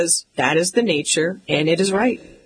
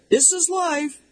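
much higher, a far-off mock call; dad calling again; 10 semitones higher than usual. Dad repeating from upstairs, high far-off mock call. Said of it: "my dad calls".

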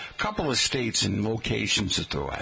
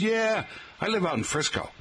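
imagined fake local; 8 semitones higher than usual. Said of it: "no one in San Francisco says".

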